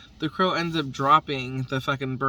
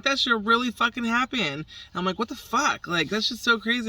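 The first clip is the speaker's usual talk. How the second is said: excited disbelief; 8 semitones higher than usual. Retold or imitated. To the neighbor about the bird, high and excited. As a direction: pitch up and gush. Retelling her excited reply.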